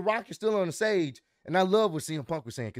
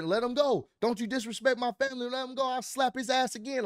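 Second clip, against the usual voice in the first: high, heated goading in imitation; The Rock; 7 semitones higher than usual. Wrestler over the brawl demands they release his rival, heated goading. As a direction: fire out a retold threat, pitched up.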